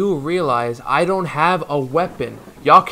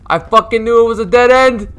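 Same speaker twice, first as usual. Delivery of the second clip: higher, louder cornered outburst; loud, raised-pitch angry yell; frustrated yell; 8 semitones higher, 9 dB louder than usual. Real, not acted. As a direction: curse the dead end out loud. Cornered at a dead end.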